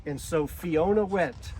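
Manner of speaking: ordinary delivery, nothing marked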